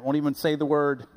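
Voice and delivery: usual speaking voice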